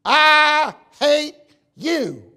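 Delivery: slow, loud canyon yell of hate; mock scream. Shown as a demonstration. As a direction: scream it slowly across the canyon. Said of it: "raise your voice as loud as you can and scream".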